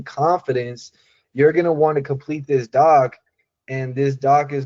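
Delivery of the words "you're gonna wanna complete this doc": normal talking voice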